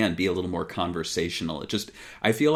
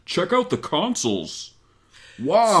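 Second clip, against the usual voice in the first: put-on mascot voice, building louder; Sonic the Hedgehog; 11 semitones higher, 5 dB louder, slower than usual. Host mimicking the hotel room recording of Sonic, showy voice. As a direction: show off the room in a hyped cartoon voice. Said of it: "they gave Sonic a low voice".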